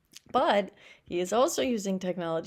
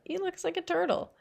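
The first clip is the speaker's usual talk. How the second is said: much higher, lightly mocking kid; child's voice; 11 semitones higher than usual. A narrator's reading. Child jeering at the robot, high-pitched.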